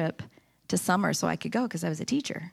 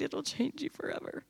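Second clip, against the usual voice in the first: much softer and hushed; soft repetition; 5 semitones higher, 9 dB quieter than usual. Real, not acted. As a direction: let it land in a near whisper. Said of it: "repeated softly".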